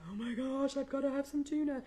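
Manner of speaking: high, put-on voice of a desperate pet; cartoonish high-pitched animal talk; pleading pet voice. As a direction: voice the pet begging in mock urgency. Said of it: "So he's like".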